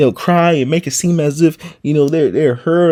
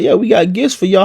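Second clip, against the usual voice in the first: higher, louder mock-friendly offer; fake friendly colonizer; 7 semitones higher, 4 dB louder than usual. Columbus offering gifts to natives, mock friendly. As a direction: play the sly giver, falsely generous. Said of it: "You know, he's like".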